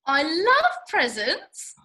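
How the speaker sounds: slow, gushing and loud; drawn-out delight at a gift. Acted. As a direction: gush over the present.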